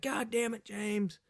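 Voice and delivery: high mock outcry; high laughing God damn it; group exclaim. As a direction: holler at the naked jumper. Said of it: "everybody's like".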